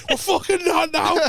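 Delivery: high, slowed mock refusal; retold irate outburst; angry dad. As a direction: imitate dad snapping, pitched well up. Acted dad refusing the swim.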